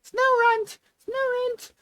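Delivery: very high, squeaky creature cry; Pokemon cry. Pretend. Streamer pretends to battle as a Pokemon, high-pitched cry. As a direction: squeal the name like a little Pokemon. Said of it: "pretend to fight the air as a Pokemon".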